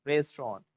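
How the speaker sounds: "रेस्टोरेंट" as RES-tron, no final t; two syllables in "रेस्टोरेंट", ending -ron; model pronunciation. Shown as a demonstration. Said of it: "बोलो".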